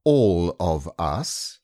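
slow, deliberate words; very slowly and carefully. A narrator's reading. Rabbit counts everyone present, slow and careful. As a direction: weigh each word carefully. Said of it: "very slowly and carefully".

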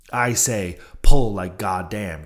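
loud, forceful answer with a drawn-out finish; crying out. A narrator's reading. The Indian answering Stubb's challenge, crying out. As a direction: punch it out as a rowing cry. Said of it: "cried the Indian".

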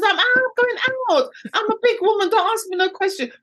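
high, sassy mother refusing questions; sassy brush-off. Retold or imitated. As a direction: play the grown woman shutting them down. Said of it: "I said".

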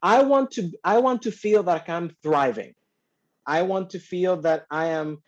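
ordinary delivery

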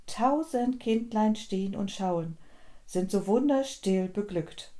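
hushed, devout poem reading; poem recitation. A narrator's reading. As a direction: recite it quietly and reverently, letting each line settle. Reads Eichendorff Christmas poem.

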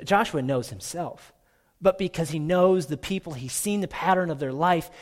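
ordinary talk, nothing marked

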